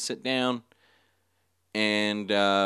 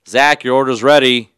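much louder, higher cashier shout; insistent order call; 5 semitones higher, 14 dB louder than usual. Retold or imitated. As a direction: shout it across the room to a missing customer. Cashier calls absent customer louder.

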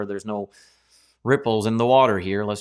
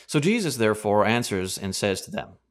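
ordinary delivery

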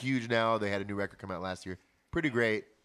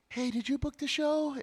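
much higher, slow, reedy-sounding impression of the singer; singer's high voice; slower than usual. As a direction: imitate his high thin speaking voice. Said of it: "That's how he talks".

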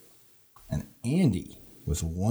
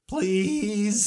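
pleading pickup line; 10 semitones higher than usual. Acted.

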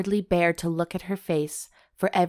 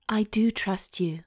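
shaky voice; 4 semitones higher than usual. A narrator's reading. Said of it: "she said in a shaky voice".

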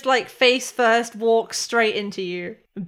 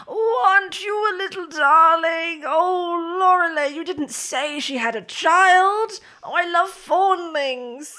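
high, gushing coo; doting grandmother; 8 semitones higher, 4 dB louder than usual. Acted. Grandmother bouncing a toddler in her arms, high gushing fondness. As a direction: coo over the little one with big swooping delight. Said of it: "she kind of bounces her and goes".

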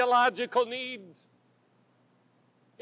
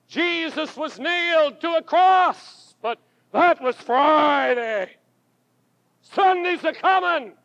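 building preaching; 4 semitones higher, 3 dB louder than usual. Acted.

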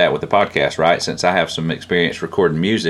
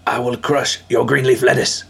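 villain voice; 6 semitones higher than usual. Pretend. Silly threat in put-on voice.

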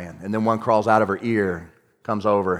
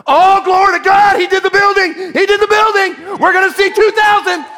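high, exultant shouts of praise; acted-out heavenly celebration, voice soaring; jubilant cheering; 9 dB louder than usual. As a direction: cry it out like a crowd celebrating victory.

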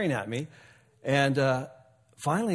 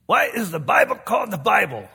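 high, loud, badly faked Scottish brogue; mock Scottish accent, overdone on purpose; terrible Scottish brogue; 6 semitones higher, 9 dB louder than usual. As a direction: ham up a Scottish brogue for the punk's question. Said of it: "that's a terrible brogue there".

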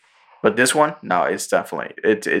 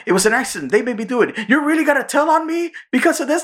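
high, rising panicked protest; mock desperate excuse; faster than usual. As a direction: plead your case, getting higher and more frantic.

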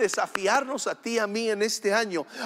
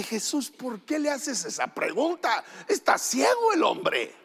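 incredulidad. Retold or imitated. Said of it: "Yo como que".

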